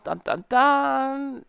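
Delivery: soap-opera reveal music, sung slowly; mock dramatic sting. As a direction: hum a cheesy TV reveal sting.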